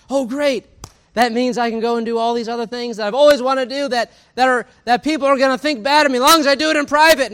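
high, loud and gleeful, mimicking someone who found a loophole; mock eager delight. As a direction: gush it out with exaggerated glee.

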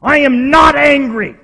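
hammed-up rage, building to a threat; furious denial. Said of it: "you know what they would say".

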